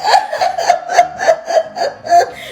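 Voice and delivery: high breathy wail; child's wailing cry. Retold or imitated. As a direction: wail like a hurt toddler. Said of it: "That baby said".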